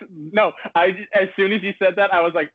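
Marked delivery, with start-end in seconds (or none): none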